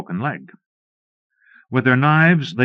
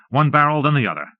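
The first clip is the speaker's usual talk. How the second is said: plain reading voice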